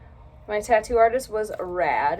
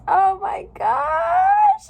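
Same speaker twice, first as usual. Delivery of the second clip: much higher, much louder and slow, near tears; an overwhelmed squeal of real joy; ecstatic shriek; 10 dB louder, much slower than usual. Real, not acted. First look at new tattoo.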